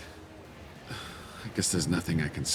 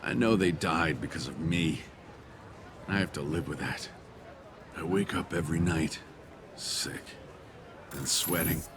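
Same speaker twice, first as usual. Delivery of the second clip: low, halting, guilt-ridden; weary, lowered voice full of remorse; haunted confession; 4 semitones lower, 4 dB quieter than usual. Acted. Guilty man confesses his torment.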